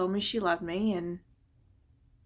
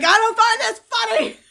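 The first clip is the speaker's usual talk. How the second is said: loud, high, offended protest; indignant teen; 13 semitones higher, 10 dB louder than usual. Retold or imitated. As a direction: snap back, insulted. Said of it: "I was so mad".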